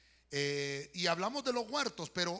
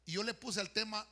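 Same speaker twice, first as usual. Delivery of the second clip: ordinary delivery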